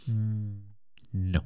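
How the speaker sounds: a soft, flat "Mm, no." through the teeth; curt dismissive no. Retold or imitated. A snooty garage salesman turning away a new rider. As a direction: brush the customer off. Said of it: "who just kind of went".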